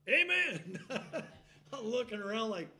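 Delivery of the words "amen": high, loud amen with a laugh. Retold or imitated. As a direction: blurt out amen in surprise. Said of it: "I found myself going".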